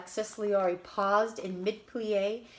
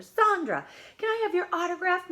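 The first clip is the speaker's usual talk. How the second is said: much higher, louder starstruck shout; excited fan call; 10 semitones higher, 5 dB louder than usual. A narrator's reading. Missus Martinez calling to the star for an autograph, thrilled. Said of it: "Missus Martinez called out".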